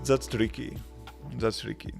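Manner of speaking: ordinary talk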